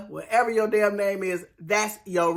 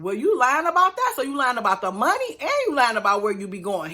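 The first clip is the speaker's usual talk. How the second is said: high, loud incredulous challenge; mock outraged wife catching the lie; indignant wife; 6 semitones higher, 5 dB louder than usual. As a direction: jump way up in disbelief. Wife confronting lying husband.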